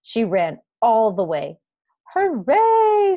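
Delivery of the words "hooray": much higher, louder joyful cheer; happy girl cheering on her way to school. Acted. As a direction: burst out with delight. Girl running to first school day.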